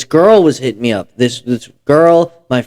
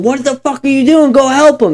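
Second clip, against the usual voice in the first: high-pitched mock yell, no real volume; strained high voice of alarmed bystanders; urgent retold yelling; 11 semitones higher than usual. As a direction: imitate panicked friends urging someone on. Retold urging from the back seat.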